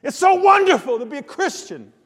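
high and loud, beaming with joy; excited friend. Retold or imitated. Preacher imitating excitable John praising faith, high joyful cry. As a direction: gush the words with wide-eyed delight. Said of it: "Can you kinda hear him saying that, that way".

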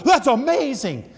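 high, loud, drawn-out exclamation; gushing amazement. Retold or imitated. Grandparent cheering a long soccer shot, gushing amazement. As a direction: stretch it out in mock wonder.

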